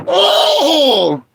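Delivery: high, sudden cry; startled oh. Real, not acted. Gamer yelping at a sudden scare. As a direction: yelp in surprise.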